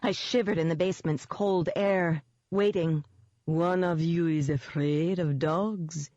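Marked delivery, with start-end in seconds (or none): 3.5-6.1 slow, sweetly amused beast voice; amused demon dog. A narrator's reading. Demon dog taunts two frightened people, amused. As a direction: toy with them slowly, amused. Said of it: "sounding amused".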